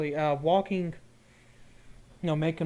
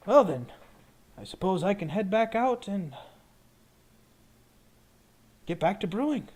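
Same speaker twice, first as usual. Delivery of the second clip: higher-pitched shopkeeper, pausing; potion seller wrapping up; 6 semitones higher, 4 dB louder than usual. Acted. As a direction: sign off as the potion brewer. Potion seller wraps up the sale.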